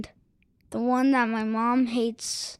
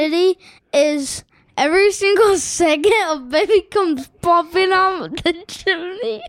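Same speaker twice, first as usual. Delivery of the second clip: silly; 6 semitones higher, 4 dB louder than usual. Real, not acted. Silly joke answer, laughing.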